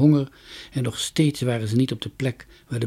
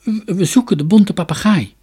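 a bit scared; 8 semitones higher, 8 dB louder than usual. A narrator's reading. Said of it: "werd een beetje bang".

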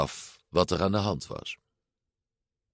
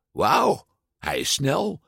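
higher, amazed remark; impressed; 6 semitones higher, slower than usual. A narrator's reading. Mother watches her son's bike tricks, amazed and higher-pitched. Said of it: "te erg onder de indruk".

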